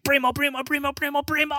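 very high squealed mock greeting; rapid-fire squeal, way up high, hammed-up.

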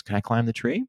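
a hint of a small child asking permission; Madeline. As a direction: ask it lightly like a kid. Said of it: "And Madeline goes".

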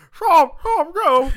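mumbling with a full mouth; mouth full of bread. Retold or imitated. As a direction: mouth full, mushy words. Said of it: "I'm like".

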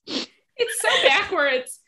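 loud, high, slow "What? It's so backwards."; incredulous outburst. Real, not acted. Reacting to cohost about Chuck, loud incredulous outburst. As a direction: disbelief bursting out loud and high.

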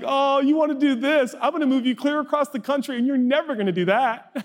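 high, laughing, teasing taunt; gleeful mock God. God joking about imploding your dreams, high laughing glee.

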